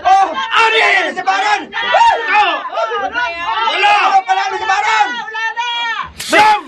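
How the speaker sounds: loud shouted count; referee count. Acted. Referee shouts the knockdown count over the fallen fighter. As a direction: call each number to the ring.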